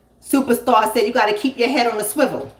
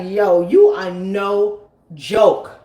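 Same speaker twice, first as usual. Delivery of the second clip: very slow, lower drawn-out mock cousin; 4 semitones lower, much slower than usual. Cousin teasing the comedian, slow and drawn out. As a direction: stretch it out like the amused cousin.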